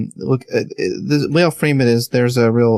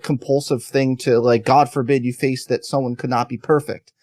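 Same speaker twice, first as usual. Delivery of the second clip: ordinary talk, nothing marked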